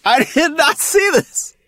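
high, loud, laughing surprise; laughing disbelief. Real, not acted. Hearing about the chocolate man ad, high laughing shock. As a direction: squeal in amused shock.

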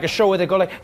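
normal talking voice

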